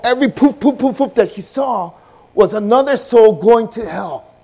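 high, loud fervent preaching; preaching at full force. Acted. Preacher telling the congregation it gets real, fervent and loud. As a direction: drive the message home with fire.

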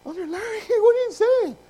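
very high squealed objection, rising to loud; mock indignant protest. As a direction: squeal back at the elder in disbelief. Acting out protest to the elder.

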